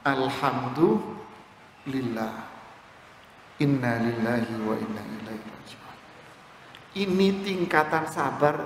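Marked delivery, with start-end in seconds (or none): 0.1-6.0 serene acceptance. Acted. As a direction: accept the loss in deep peace. Father accepting child's death.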